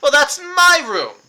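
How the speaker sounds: loud, high and slow proud boast. Acted. Reginald brags to the party about his room of death, slow and loud. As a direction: puff up and savour the brag.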